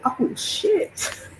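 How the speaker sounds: high, breathless whisper; whispered shock. Retold or imitated. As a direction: gasp it under your breath. Realizing it is really him.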